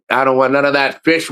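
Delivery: ordinary delivery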